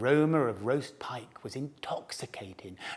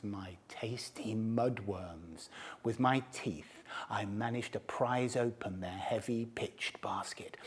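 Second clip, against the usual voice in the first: softer, whispered relish; conspiratorial whisper; 4 semitones lower, 12 dB quieter than usual. A narrator's reading. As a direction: whisper the secret theft. Secretly stealing the eels.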